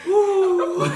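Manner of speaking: loud high ooh from the burn; pained ooh. Real, not acted. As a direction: yelp as the heat hits. Spice hits all at once.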